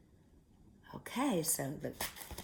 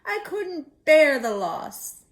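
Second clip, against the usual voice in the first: put-on slow, higher and louder old woman's lament; grieving old woman; 8 semitones higher, 8 dB louder, slower than usual. A narrator's reading. Old woman dreads another loss, slow tearful lament. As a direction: cry it out like a heartbroken granny. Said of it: "cried the old woman".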